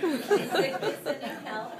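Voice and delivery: laughing, slow and loud. Real, not acted. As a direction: laugh while reading it out. Laughing at purring joke.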